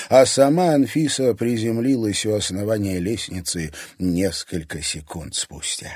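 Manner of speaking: usual speaking voice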